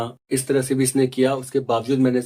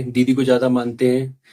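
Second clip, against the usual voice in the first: ordinary talk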